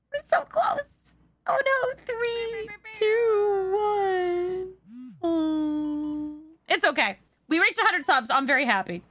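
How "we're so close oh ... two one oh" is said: whiny countdown. Real, not acted. Hype train countdown runs out.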